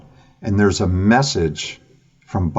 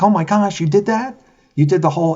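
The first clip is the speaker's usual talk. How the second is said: amazed; 11 semitones higher than usual. Retold or imitated. Amazed at friend's hand-drawn map.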